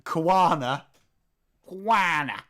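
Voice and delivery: the name savoured in a northern accent. Shown as a demonstration. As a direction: roll "Kwana" off in northern tones. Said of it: "Kwana rolls off my accent".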